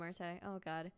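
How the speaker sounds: normal talking voice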